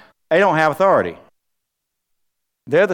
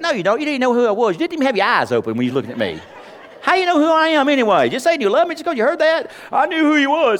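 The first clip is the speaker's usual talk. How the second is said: high-pitched mock argument; playful protest; 9 semitones higher than usual. Husband teasing his half-asleep wife in bed, high playful protest. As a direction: bicker playfully in a raised, higher voice.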